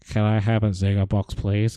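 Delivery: ordinary talk, nothing marked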